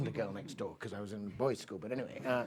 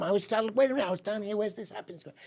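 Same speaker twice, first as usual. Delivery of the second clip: ordinary talk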